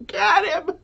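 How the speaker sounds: high, laughing cheer; giggly mock-cheer to catch the raccoon; laughing urge. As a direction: laugh it out while egging them on. Laughing while urging them on.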